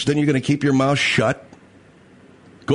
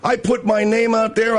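ordinary talk

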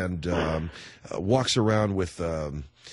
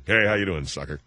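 macho guy voice; 3 semitones lower than usual. Retold or imitated. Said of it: "full-on drag Santa Claus costume".

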